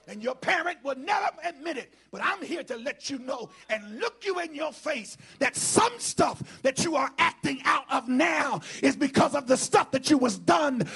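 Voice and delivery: loud, high, driving preacher's shout; full-force preaching. Real, not acted. Preacher confronting congregation about parents, shouting at full force.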